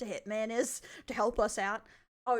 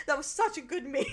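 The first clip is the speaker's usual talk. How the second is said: usual speaking voice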